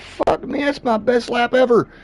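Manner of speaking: high, pleased inner cry of the best lap; lightly acted proud exclamation; mock delight. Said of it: "You know, I thought".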